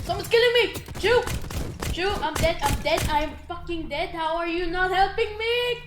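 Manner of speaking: frantic, loud cries for help; panicked yelling. Real, not acted. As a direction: yell in panic at the teammate. Being killed in game.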